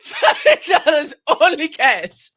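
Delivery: mock quote through laughter; laughing quote. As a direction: repeat the line while cracking up. Quoting clip while laughing.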